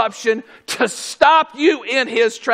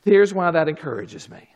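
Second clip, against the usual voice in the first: ordinary talk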